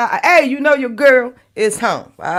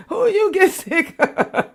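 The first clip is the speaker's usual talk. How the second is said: laughing; 9 semitones higher, slower than usual. Real, not acted. Laughing question.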